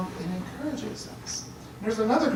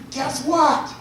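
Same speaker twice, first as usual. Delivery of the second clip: higher, louder eager call; excited news; 7 semitones higher, 6 dB louder than usual. Retold or imitated. Disciples rushing to tell Thomas, excited. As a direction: burst in with big news.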